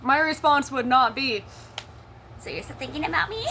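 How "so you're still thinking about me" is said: fast, soft, high whiny mimicry; mock whiny sneer. Mimicking her ex's smug phone reply, whiny sneer. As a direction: sneer it back in mockery.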